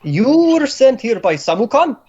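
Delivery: put-on incredulous, high-pitched Slavic accent. Boss doubts who sent captive, incredulous Slavic accent. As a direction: question in disbelief with a Slavic accent. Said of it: "pretty good Slavic ac-, accent".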